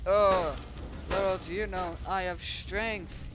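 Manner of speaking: loud, high, slowing to a dramatic finish; play-acted boast. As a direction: reveal hidden power like a cartoon fighter. Figure boasts before fighting back.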